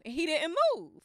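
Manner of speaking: ordinary talk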